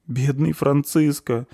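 slow, high tearful cry; sobbing. A narrator's reading. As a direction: mourn him through a sob. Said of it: "всхлипнула Завирушка".

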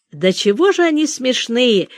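usual speaking voice

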